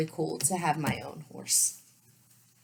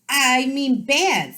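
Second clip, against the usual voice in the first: correcting himself; 8 semitones higher, 9 dB louder, much slower than usual. Acted. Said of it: "the king cried".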